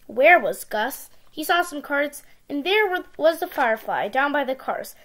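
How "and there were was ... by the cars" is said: ordinary delivery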